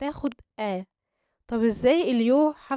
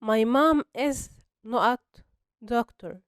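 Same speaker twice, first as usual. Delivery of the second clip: slow exercise reading; much slower than usual. Shown as a demonstration. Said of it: "نرجع للإكسرسايز".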